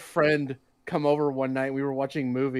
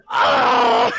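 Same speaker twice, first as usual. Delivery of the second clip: mock pained cry; 8 dB louder than usual. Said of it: "I'm just like".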